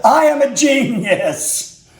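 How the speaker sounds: slow, high, hammed-up self-praise; mock proud boast. Real, not acted. Vlogger boasting to viewers after fixing lid, mock proud. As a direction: crow about your own genius, drawn out.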